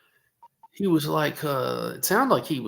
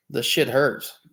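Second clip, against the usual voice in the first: ordinary talk, nothing marked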